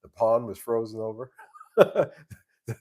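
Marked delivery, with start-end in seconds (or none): 1.4-2.3 amused laugh. Real, not acted. Laughs at own duck joke.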